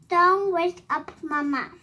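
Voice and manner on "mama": plain reading voice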